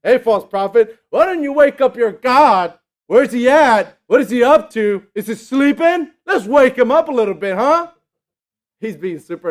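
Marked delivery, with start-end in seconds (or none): 0.0-7.9 loud, higher heckling taunt; mocking jeer. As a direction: needle them like an annoying heckler. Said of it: "I don't think he's doing it with a nice voice".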